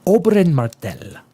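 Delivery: "Oberyn Martell" with a hint of Spanish accent; light Spanish accent on the name. Shown as a demonstration. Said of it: "I even say with a Spanish accent".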